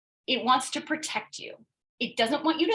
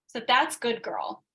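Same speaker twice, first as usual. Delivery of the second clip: ordinary talk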